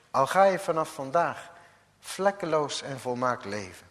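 ordinary talk, nothing marked

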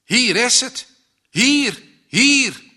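hoog en luid, dringend; dringend roepen. A narrator's reading. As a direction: roep vader dringend toe. Jongen wijst vader de plek. (dringende uitroep)

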